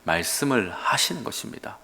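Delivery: ordinary delivery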